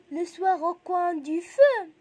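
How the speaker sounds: loud, slow recitation for pupils; slow, projected classroom poem reading; punctuated poem recital. Shown as a demonstration. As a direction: recite deliberately, lifting the end of the line. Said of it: "de respecter la point".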